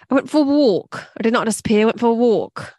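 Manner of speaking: put-on English-accented film line; Nicole Kidman. Quoting Virginia Woolf from The Hours, English accent. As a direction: copy a film star's British accent. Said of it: "I always copy Nicole Kidman from The Hours".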